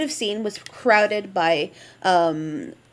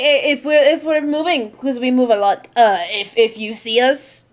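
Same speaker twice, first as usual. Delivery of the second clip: put-on Vistani accent; 3 semitones higher, 4 dB louder than usual. NPC offering the party a ride in light Vistani accent. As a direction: call after them in a hint of Vistani accent. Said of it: "I'm using the Vistani accent for him".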